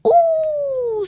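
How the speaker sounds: sobbing dragon. A narrator's reading. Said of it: "sobbed the dragon".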